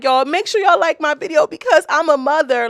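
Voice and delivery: loud, high mock begging; mocking plea. Mocking moms who beg viewers for likes, loud. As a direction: parody a fake sympathy plea. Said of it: "And that's like".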